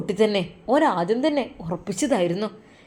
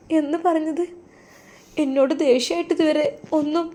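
put-on higher, doubting young woman; young wife's worried voice; 6 semitones higher than usual. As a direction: ask in disbelief, voice lifted. Muhsina doubts her husband. (സംശയത്തോടെ ഉയർന്ന ശബ്ദത്തിൽ ഭാര്യ)

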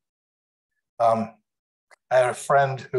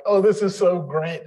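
joyful mother; 6 semitones higher, 4 dB louder than usual. Retold or imitated. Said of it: "She's like".

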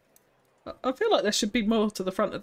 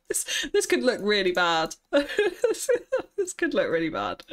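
louder, giggling through the words; real laughing; 3 semitones higher, 4 dB louder, slower than usual. Real, not acted. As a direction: laugh while admitting a likely flop. Laughing at own risky building idea.

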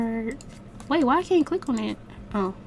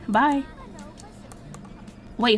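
usual speaking voice

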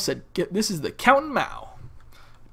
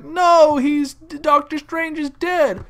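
raised, drawn-out cry of "no"; mock dismay; 7 semitones higher, 4 dB louder, much slower than usual. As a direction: retell the crowd's panic, high and loud at first. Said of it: "and they were like".

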